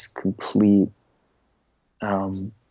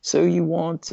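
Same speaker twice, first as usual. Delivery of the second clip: British accent attempt; 8 semitones higher, slower than usual. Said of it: "I can only do British".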